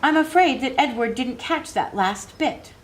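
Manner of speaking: put-on a touch of a proper ten-year-old asking; polite little girl. A narrator's reading. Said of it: "Abilene would say".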